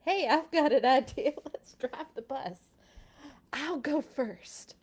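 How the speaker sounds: lightly voiced eager kid. A child from class proposing the game, lightly eager. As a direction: act out a scheming child. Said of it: "one of my kids being like".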